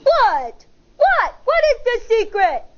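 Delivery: eager, rising high on the second what; a little bug bursting with excitement; excited. A narrator's reading. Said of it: "gets so excited".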